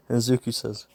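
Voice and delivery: ordinary delivery, nothing marked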